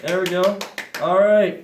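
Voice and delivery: loud, pleased cheer; excited. Real, not acted. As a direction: celebrate as it lifts off. Balloon finally rises.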